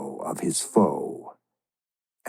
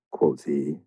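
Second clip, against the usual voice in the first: plain reading voice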